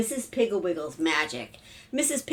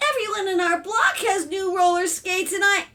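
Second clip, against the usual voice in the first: put-on high, loud child's complaint; clamoring daughter; 11 semitones higher, 4 dB louder than usual. A narrator's reading. Daughter argues over siblings, loud whiny child. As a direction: talk over everyone like a kid. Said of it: "the talk became louder and louder".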